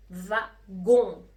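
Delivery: slow, loud "فاغون", split "va... gon"; teacher's drawn-out model of "فاغون"; syllable by syllable. Shown as a demonstration. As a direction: break "فاغون" in two, punching the nasal "gon".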